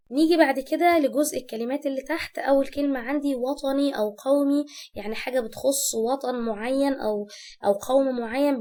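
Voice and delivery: ordinary talk, nothing marked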